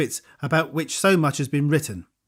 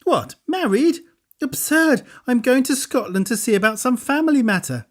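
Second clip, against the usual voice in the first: put-on amused girl; 9 semitones higher than usual. A narrator's reading. Girl's voice, amused.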